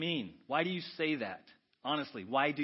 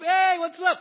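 much higher, loud cheery hello; breezy greeting; 12 dB louder, slower than usual. Acted. Clubgoer greeting the bouncer, cheery and loud. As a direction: greet the bouncer like an old friend. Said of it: "greeting the bouncer".

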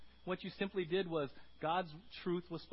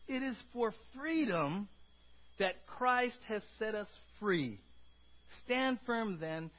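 slow, weighty reading of the verse; reading the verse slowly to the congregation; slow scripture reading; 4 semitones higher, slower than usual. Real, not acted. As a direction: savor each word of the verse. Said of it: "I love Galatians five one".

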